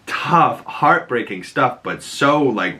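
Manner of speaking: usual speaking voice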